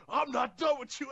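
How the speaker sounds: mock menacing yell. Imitates wrestler's defiant line.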